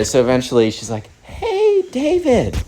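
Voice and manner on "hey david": very high singsong greeting; put-on sugary high voice; sweet mom. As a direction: imitate a mom being nice to a guest. Mom sweetly addresses the guest.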